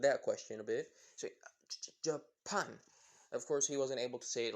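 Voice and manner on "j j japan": put-on halting Japanese-accented English, stuttering the start. Boy with little English says where he's from, in a Japanese accent. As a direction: stammer it out in a light Japanese accent. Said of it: "there's, like, that Japanese accent there".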